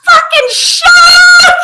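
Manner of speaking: high, loud, slow shriek; screaming that a shark is coming; panicked shout. Retold or imitated. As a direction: yell it in terror. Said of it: "She goes".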